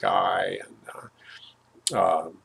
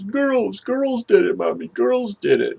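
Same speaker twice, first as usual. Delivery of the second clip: put-on crying child; 10 semitones higher, 5 dB louder, slower than usual. Crying little sister tells mom.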